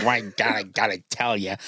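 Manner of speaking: a lightly voiced, bad American accent; mock a comedian's clumsy American accent; Vic Reeves American accent. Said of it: "Vic Reeves doing it".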